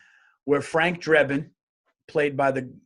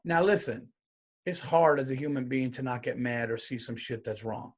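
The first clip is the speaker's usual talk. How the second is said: ordinary talk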